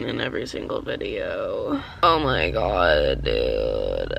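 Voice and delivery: tearful, low and slow; crying. Real, not acted. A stressed student crying about her car, tearful. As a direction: choke it out through tears. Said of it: "literally crying".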